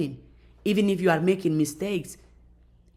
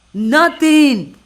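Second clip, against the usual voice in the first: Nothing, louder and higher, ringing; emphatic Nothing; 5 semitones higher, 9 dB louder than usual. Real, not acted. Rejecting negativity to viewers, loud emphatic Nothing. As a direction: hammer down the word Nothing.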